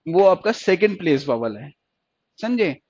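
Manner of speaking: usual speaking voice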